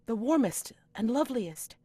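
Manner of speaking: high, loud piping announcement; piping attendant. Acted. Attendant announcing her lady's name, high and loud. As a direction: pipe up like an eager servant. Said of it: "the other one will pipe up".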